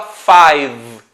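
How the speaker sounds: five drawn out, ending on the v sound; "five" stretched onto a final v; silent final E. Shown as a demonstration. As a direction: say "five" with no vowel after the v. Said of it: "geralmente o E não é pronunciado".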